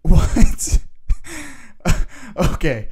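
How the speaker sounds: loud, high burst of disbelieving laughter; shocked laughter. Real, not acted. Reacting to the clerk's dead grandma, loud shocked laugh. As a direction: crack up in disbelief.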